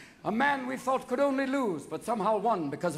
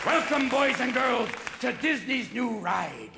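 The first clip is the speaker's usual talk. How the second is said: theme park announcer. Acted. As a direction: welcome the children like a ride announcer.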